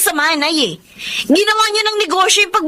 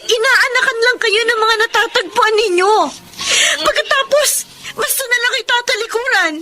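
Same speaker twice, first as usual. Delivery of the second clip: tearful reproach; 2 semitones higher than usual. Acted. Daughter begging mother to stop chasing men, tearful reproach. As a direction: scold through tears, ending in a cry.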